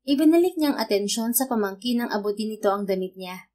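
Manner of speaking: ordinary delivery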